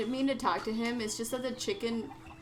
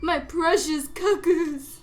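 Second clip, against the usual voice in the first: higher, louder, slow lament; slow, pleading lament; 6 semitones higher, 8 dB louder, slower than usual. Acted. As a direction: draw it out, pleading. Voicing distressed cuckoo lady.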